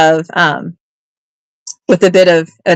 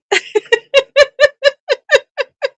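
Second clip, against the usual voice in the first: long, high, breathless giggling; high giggle fit. Real, not acted. Seller cracking up at a viewer's joke, giggling. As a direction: laugh helplessly at the chat. Laughing hard after joke.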